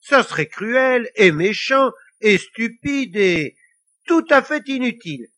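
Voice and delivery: put-on high, loud, emphatic child's protest; indignant scolding. A narrator's reading. Lily scolding the devil for his plan, indignant. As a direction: hammer out each reproach.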